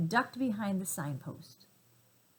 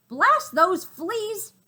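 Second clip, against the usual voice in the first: slow, much higher and louder roar; raging; 11 semitones higher, 10 dB louder, much slower than usual. Acted. Gorilla tormented by fleas, raging slow roar. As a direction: bellow it out in fury. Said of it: "he raged".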